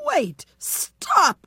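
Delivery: high, loud alarmed cry. A narrator's reading. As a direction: call out to halt them. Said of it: "cried the baker woman's husband".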